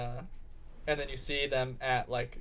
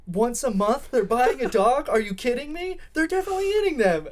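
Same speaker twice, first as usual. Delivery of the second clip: loud, high-pitched incredulous rant; outraged disbelief; 10 semitones higher, 8 dB louder than usual. Acted. Girl reacting to the monthly dog adoptions, outraged. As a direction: exclaim shrieking, hammed-up outrage. Acted girlfriend outraged by news.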